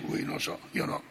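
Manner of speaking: whispered admission; hushed aside. Real, not acted. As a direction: drop to a near whisper. Humble aside to congregation.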